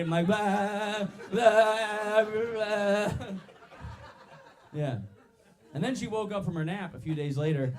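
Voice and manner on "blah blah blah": long, loud, higher vibrating "blah"; imitating vibration. Comedian showing himself learning to vibrate, high droned blahs. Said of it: "teach myself how to vibrate".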